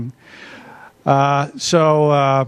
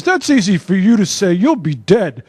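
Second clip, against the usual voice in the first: a lightly voiced five-year-old, pitched higher and louder; imitating crying son; 4 semitones higher, 4 dB louder than usual. Little son answers his reassuring father, mock child voice raised higher and louder. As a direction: play the tearful little boy who snaps back at his dad. Crying son's retort in dad's anecdote.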